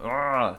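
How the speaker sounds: drawn-out groan of frustration; exasperated groan. Real, not acted. Podcaster fed up with the plot's phone signal, exasperated groan. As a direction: groan at the nonsense of it.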